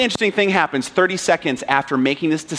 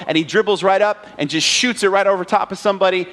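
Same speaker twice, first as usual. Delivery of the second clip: usual speaking voice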